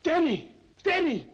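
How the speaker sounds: loud, high, urgent calls; yelling for Denny to come see; excited calling. Acted. Roommate shouting for Denny, excited.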